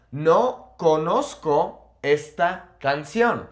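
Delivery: slow, word by word, "No conozco esta canción" with pauses; slow model sentence. Shown as a demonstration. Teacher modelling a Spanish sentence for learners, slow and spaced out. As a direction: spell out each word slowly for learners.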